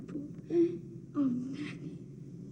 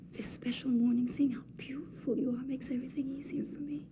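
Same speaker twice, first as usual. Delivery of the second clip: soft whispered affection; tender whisper; 6 dB quieter than usual. Acted. Antigone telling Ismene she is beautiful, whispered. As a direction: hush it lovingly to her sister.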